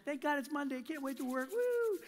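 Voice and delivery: high-pitched pretend glee ending in a whoop; mock enthusiastic. One person thrilled for Monday work, mock enthusiasm. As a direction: gush like an eager worker, then whoop. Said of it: "one person just enthusiastic".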